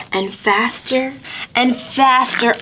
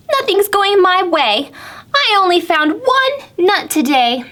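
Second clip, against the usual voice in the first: high, whiny squirrel voice; a squirrel griping about a bad day; Squirrel complaining; 10 semitones higher than usual. Squirrel telling Pete about his bad day, high and whiny. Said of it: "said Squirrel".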